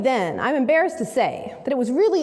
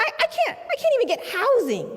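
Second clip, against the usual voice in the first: plead, pitched way up; desperate plea; 11 semitones higher than usual. Retold or imitated. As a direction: voice leaps much higher in despair. Client's desperate plea retold.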